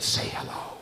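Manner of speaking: whispered, imitated stage prompt; soft retold whisper; hushed prompt. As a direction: whisper it as a discreet cue. Prompting him toward Judy upstairs.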